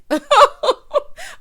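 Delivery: high, loud cackling laugh. Real, not acted. Host amused by the Lindsay Lohan nickname, laughing. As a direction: burst out laughing at your own joke.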